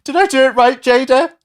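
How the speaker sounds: high, loud, pleading whine; begging an ex back. Pretend. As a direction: beg her not to leave. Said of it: "I should voice memes more".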